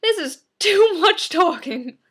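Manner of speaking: loud, high, drawn-out complaint; mock the friend's whine, slow and loud; exasperated friend. Friend balking at the wordy script, loudly exasperated. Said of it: "And she's like".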